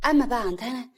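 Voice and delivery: ordinary delivery, nothing marked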